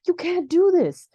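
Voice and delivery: loud, high-pitched mock outcry; hammed-up upset objection; extremely upset. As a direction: imitate a distraught protest, voice shooting up. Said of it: "Harvey is extremely upset".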